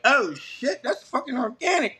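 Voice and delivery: high-pitched starstruck fan reaction; fanned out. Retold or imitated. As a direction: replay the giddy thrill of spotting an idol. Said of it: "I was fanned out a little bit".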